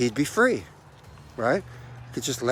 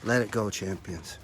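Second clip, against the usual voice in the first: soft closing; 6 semitones lower, 10 dB quieter than usual. Real, not acted. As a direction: ease into a gentle close. Soft closing over music.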